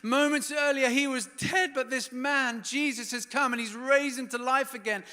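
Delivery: high, loud, breathless wonder; amazed gratitude. Retold or imitated. Mary watching Lazarus eat with Jesus, thrilled and grateful. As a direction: gush with thankful amazement. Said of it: "she's like".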